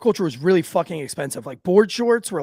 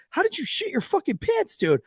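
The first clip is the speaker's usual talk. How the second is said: squeaky high, softer mock outrage; incredulous scolding; 11 semitones higher, 4 dB quieter than usual. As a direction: mimic a friend who can't believe it. Friends berate him in disbelief.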